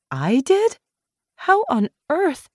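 surprised. Acted. Caro learns she saved them.

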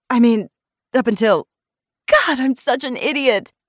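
stumble, then scold yourself; flustered self-reproach. A narrator's reading. Blurts gaffe, blushes and berates herself.

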